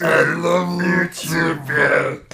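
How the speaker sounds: slow put-on Beast voice. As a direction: growl it slowly like the Beast. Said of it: "Can you still do the Beast voice?".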